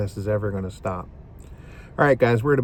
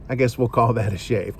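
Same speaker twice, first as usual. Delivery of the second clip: ordinary talk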